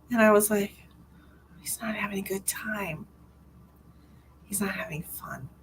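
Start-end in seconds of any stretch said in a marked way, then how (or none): 1.6-5.5 very soft, wounded whisper; hurt whisper. Retold or imitated. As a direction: confide sadly under your breath, acting it out. Retelling her hurt private thought.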